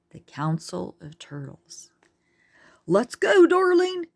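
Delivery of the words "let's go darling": much higher, loud urgent call; urging. A narrator's reading. Tor urging Charlene across the busy street, loud urgent call. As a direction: hurry her along. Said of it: "to urge Charlene across the street".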